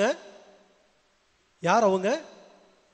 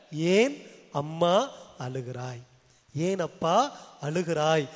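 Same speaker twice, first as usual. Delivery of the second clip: slow, lowered, gentle appeal; tender questioning; 3 semitones lower, much slower than usual. Acted. God asking a weeping mother and father, tender questioning.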